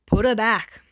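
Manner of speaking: loud, curt grunted order. A narrator's reading. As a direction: bark it out short. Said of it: "Dabi grunts".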